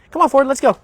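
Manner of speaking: loud, high "come on, Ford, let's go"; trainer's bright go command; firm upbeat command. Real, not acted. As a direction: call the dog forward with energy. Commanding the dog.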